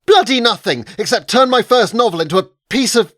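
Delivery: loud, high, angry tirade; furious shout. Acted. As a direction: hurl it at the agent at full volume. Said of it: "I shouted".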